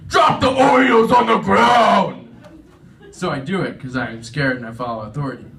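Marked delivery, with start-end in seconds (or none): 0.1-2.2 loud, high, drawn-out order; full-force commanding yell; shouted command. Acted. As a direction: shout it like an authority at a standoff. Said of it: "internal [name] is like".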